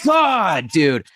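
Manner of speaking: ordinary talk, nothing marked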